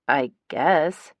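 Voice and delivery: plain reading voice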